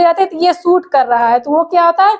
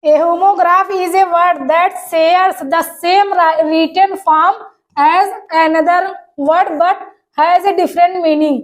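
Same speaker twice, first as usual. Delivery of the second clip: slow dictation reading; much slower than usual. Shown as a demonstration. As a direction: read the definition very slowly, word by word. Reads English definition slowly. (धीमे-धीमे परिभाषा पढ़कर सुनाना)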